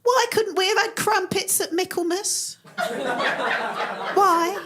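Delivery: put-on high, plaintive Victorian child ghost; mock-mournful high child voice. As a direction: wail it like a little ghost at the window. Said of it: "and saying things like".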